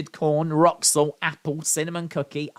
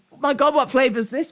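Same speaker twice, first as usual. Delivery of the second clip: fast laughing disbelief; 8 semitones higher, much faster than usual. Real, not acted.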